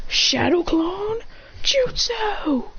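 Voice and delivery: high, very slow move name ending whispered; high slow anime call; slow jutsu call. Acted. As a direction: draw the jutsu out, breathe the end. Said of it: "and says".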